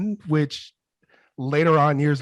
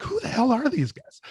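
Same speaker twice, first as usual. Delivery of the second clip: mock incredulous; 11 semitones higher than usual. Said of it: "I'm like".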